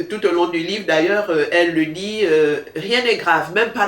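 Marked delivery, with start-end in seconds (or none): none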